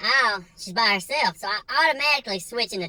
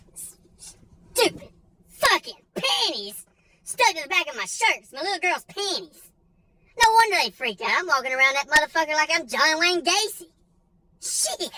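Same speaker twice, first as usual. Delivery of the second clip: higher-pitched frustrated disbelief, half crying; exasperated; 7 semitones higher than usual. Retold or imitated. Finding his daughter's panties stuck to his shirt, higher and exasperated. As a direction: groan it out in mortified outrage.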